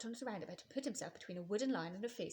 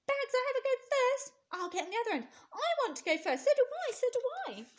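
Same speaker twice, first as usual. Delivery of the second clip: put-on much higher, louder children's voices; excited kids shouting; 8 dB louder than usual. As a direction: squabble to go first, all eager. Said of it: "shouting with excitement".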